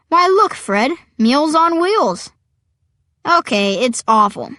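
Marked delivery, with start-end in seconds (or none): 0.1-2.3 high, louder punchline voice; joke-telling vulture. Acted.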